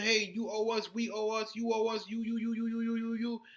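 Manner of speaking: mocking whiny demand. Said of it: "And now you're saying".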